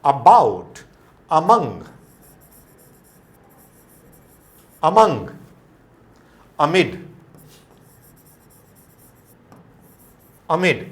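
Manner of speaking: "among", "amid" with short unstressed "a", called out loud; loud schwa drill. Shown as a demonstration. Teacher calling model words to class, loud and clear. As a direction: drill each word, punch it out. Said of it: "अ pronunciation होगा".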